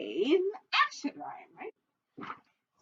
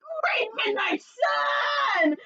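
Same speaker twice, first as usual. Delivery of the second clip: howl; much slower than usual. Acted. Fox hit on the head, howling. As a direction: howl it out in pain. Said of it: "howled Foxy Loxy".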